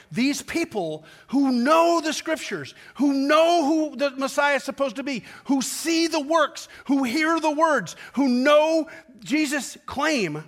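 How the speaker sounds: high, loud, slow rising cry; preaching crescendo. Acted. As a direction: build it like a lament.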